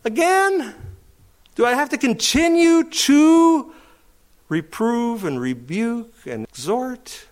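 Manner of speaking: high, put-upon groan of a tired leader; weary exasperation. Retold or imitated. Preacher voicing Timothy fed up with correcting people, exasperated whine. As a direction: whine it like you can't believe it's happening again. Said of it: "you're gonna go".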